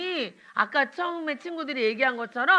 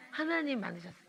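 usual speaking voice

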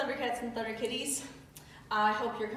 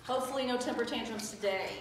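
usual speaking voice

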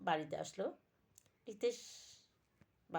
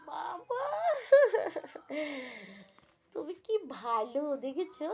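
high, crying plea; whiny pleading son; 7 semitones higher, 6 dB louder than usual. Acted. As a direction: whimper it, clinging to dad. Son hugs father pleading. (কাঁদো কাঁদো গলায় আবদার)

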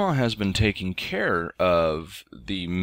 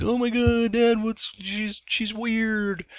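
put-on high whiny kid voice, slowing at the end; pretend kid reaction; slower than usual. Dad voicing the reaction his son never had, high and whiny. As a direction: squeal it like a freaked-out boy. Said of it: "for not being like".

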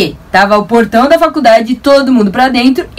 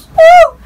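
high squealing whoop with a laugh; excited yelp; 6 dB louder than usual. Real, not acted. Cheering about the view goal, high laughing yelp. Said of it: "celebratory yelp".

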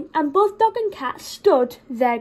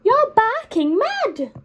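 teasing cat; 9 semitones higher, 3 dB louder, slower than usual. Acted. Cat teases dog.

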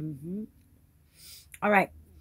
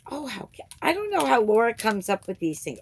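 normal talking voice

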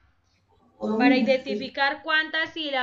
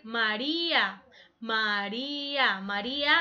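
slow syllables; 3 semitones lower, slower than usual. Shown as a demonstration. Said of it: "A va separado".

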